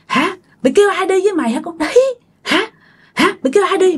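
high, fast, repeated challenges; angry cursing. A narrator's reading. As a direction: demand an answer in a scolding rush. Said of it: "Chửi vậy đó". (chửi dồn dập, giọng cao)

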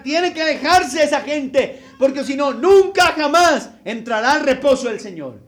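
loud, rising preacher's warning; preaching at full force. Acted. Preacher warning the congregation about sin, loud and fervent. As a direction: thunder the warning from the pulpit. List of sins, building.

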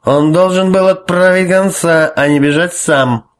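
put-on high, loud and slow drunken rant; angry drunk. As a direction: hammer it out, furious and tipsy. Priest keeps raging, drunk.

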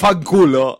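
put-on loud hammy Italian accent. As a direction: curse in a mock Italian accent. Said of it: "su gran acento italiano".

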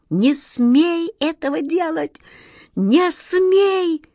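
high, stretched cry of despair; frantic high-pitched forbidding; desperate exclamation. A narrator's reading. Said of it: "в отчаянии воскликнул волшебник Алёша".

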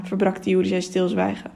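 usual speaking voice

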